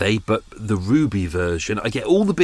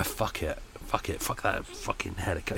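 muttered swearing, much softer; quiet curse; 4 semitones lower, 11 dB quieter than usual. Real, not acted. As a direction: curse under your breath at the noise. Helicopter noise, muttered curse.

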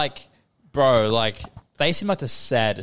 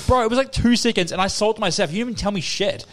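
high-pitched, worked-up retort; indignant protest; 8 semitones higher than usual. Retold or imitated. Retelling his answer to being accused of wasting time, indignant. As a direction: argue back in exasperation as you retell it. Retold argument over wasted time.

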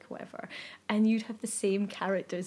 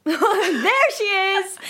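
mock proud announcement; 10 semitones higher, 7 dB louder, much slower than usual. Mocking proud Mandarin phrase.

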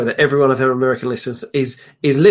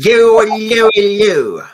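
high, loud caricature English greeting; mock English accent; 5 semitones higher, 5 dB louder than usual. Guest mimics Americans doing an exaggerated English accent. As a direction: hoot it in hammed-up English. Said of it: "Do an English accent".

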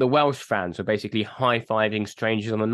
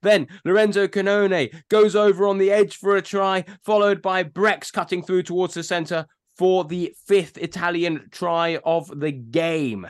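brisk match summary read; fast highlights summary; quick rugby recap; 6 semitones higher than usual. Shown as a demonstration. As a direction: rattle through the highlights like a sports round-up. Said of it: "trying to compress it into one minute".

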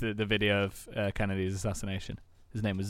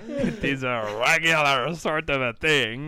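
slow, louder mock French with a Texan twang; French accent gone Texan; 3 semitones higher, 6 dB louder than usual. Defending his accent to friends, in Texan-tinged French accent. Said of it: "a bit Texan though".

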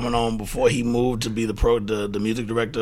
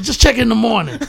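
grumpy order; 12 semitones higher, 9 dB louder than usual. Retold or imitated. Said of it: "He goes".

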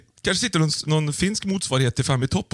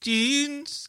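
Norwegian "Jeans", higher and soft; imitated Norwegian; 10 semitones higher, 5 dB quieter than usual. Mimicking the translator's Norwegian, monotone Norwegian accent. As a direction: echo a monotone Norwegian pronunciation. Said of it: "Lite mer entonigt".

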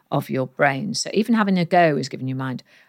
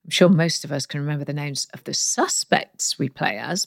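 ordinary delivery

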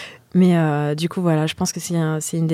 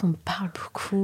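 whispering; slower than usual. Real, not acted. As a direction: breathe the words. Whispered, then repeated laughing.

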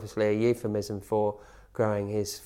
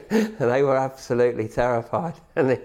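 louder, broken up by laughter; laughing while talking; 4 semitones higher, 7 dB louder than usual. Real, not acted. Player recalls terrified reporters, laughing through the words. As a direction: tell it through real laughter.

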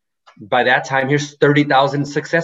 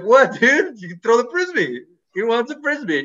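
much higher, incredulous buddy voice; mock surprised dude, pitched up; incredulous bro; 9 semitones higher than usual. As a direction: act baffled that anyone would stop. Buddy insisting dog wants Frisbee.

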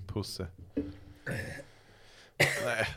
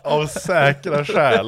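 slow, drawn-out mock quoting; slow teasing echo of the neighbour's phrase; mocking repeat; 4 semitones higher, 7 dB louder, much slower than usual. Real, not acted. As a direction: savor each word to ridicule it. Mocking quote of the note.

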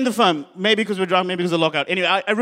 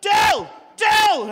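much higher, repeated calls of "Dill!"; yelling a name; 12 semitones higher, much slower than usual. Retold or imitated. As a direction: yell his name to wake him up. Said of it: "just yelling at me".

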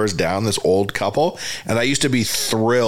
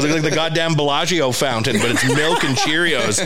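ordinary delivery

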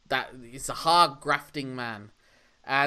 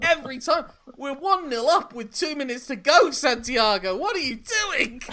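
much higher, louder cry of disbelief; exasperated yelling at screen; 10 semitones higher, 3 dB louder than usual. Acted. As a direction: shout at the player like a fan.

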